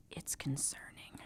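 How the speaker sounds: conspiratorial whisper; hushed, secretive whisper; whispered aside. Acted. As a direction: lean in and whisper it. Whispered aside about uncle.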